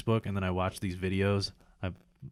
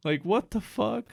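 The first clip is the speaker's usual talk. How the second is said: higher and slower, teary disbelief; choked up; 9 semitones higher, slower than usual. Retold or imitated. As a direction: let the voice go up as if welling up. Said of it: "I'm like".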